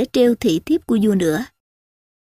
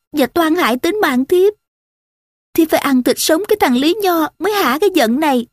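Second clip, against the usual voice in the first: high-pitched, acted aggrieved complaint; performed resentful lament, pitched up; tearful accusation; 6 semitones higher than usual. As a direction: accuse bitterly as a wronged woman. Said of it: "ôm mặt khóc rưng rức".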